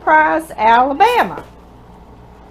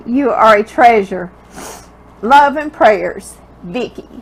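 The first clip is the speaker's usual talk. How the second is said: ordinary delivery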